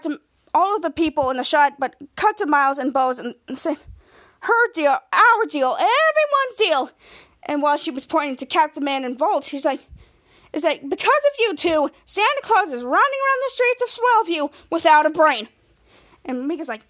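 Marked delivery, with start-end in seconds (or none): 4.4-6.9 building to a high, stretched last phrase; mock outrage, escalating phrase by phrase; indignant comeback. Group throwing the question back, indignant. 10.5-15.5 raised, high-pitched telling-off; mock scolding. Woman blaming the two heroes, exasperated.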